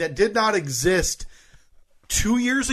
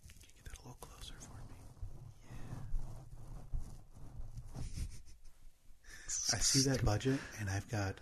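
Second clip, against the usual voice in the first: whispering; 3 semitones lower, 12 dB quieter than usual. Pretend. ASMR voice continues, fading.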